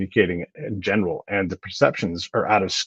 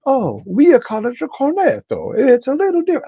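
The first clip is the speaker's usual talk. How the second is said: put-on Italian accent; 4 dB louder than usual. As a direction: play the sweet Italian cafe lady with a strong Italian accent. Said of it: "in your adorable little accent".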